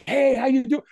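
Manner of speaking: high, friendly mock hello; effusive greeting. Elated firewalkers greeting people the next day, effusive. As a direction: greet a stranger like a long-lost friend.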